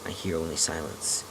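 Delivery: ordinary delivery, nothing marked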